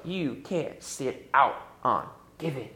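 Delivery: ordinary talk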